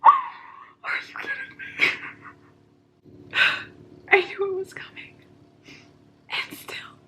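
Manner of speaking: shocked, breathless whisper; shaken. Real, not acted. Reader hit by the book's ending, shaken whisper. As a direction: barely get it out, whispering in real shock. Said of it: "I'm literally shaking reading this".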